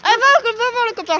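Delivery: calling a dog. Real, not acted. Urging the dog on.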